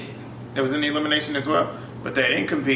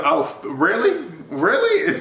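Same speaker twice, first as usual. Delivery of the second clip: slow, doubting "really?" that jumps to a high squeak; incredulous callout; 7 semitones higher, slower than usual. Retold or imitated. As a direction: call him out in mock disbelief. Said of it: "She was checking him".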